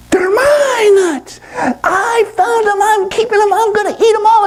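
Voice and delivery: very high squealing monkey; hammed-up greedy tantrum in a squeaky voice; possessive monkey. Monkey grabs for the nuts again, possessive squeal.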